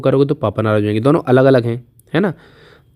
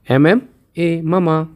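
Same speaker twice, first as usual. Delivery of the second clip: ordinary delivery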